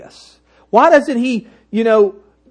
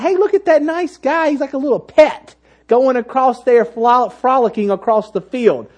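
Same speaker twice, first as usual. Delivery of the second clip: high, light, chirpy gushing over a cute pet; mock casual admiration; 4 semitones higher than usual. Shown as a demonstration. Preacher mimicking how John did not talk, mock-lighthearted. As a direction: breezily point out a harmless nice guy. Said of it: "That's not at all how he was talking".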